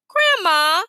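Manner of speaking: put-on sweet, wheedling little boy calling; a hint of a cute little boy pleading; best little boy voice. A narrator's reading. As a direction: coax Grandma like an innocent little boy. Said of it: "in his best little boy voice".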